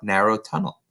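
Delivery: ordinary delivery, nothing marked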